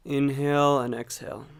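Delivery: calm meditation guide, long unhurried pauses; guided meditation. Acted. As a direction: gently guide them into watching the breath, leaving space between phrases. Leads a breathing meditation.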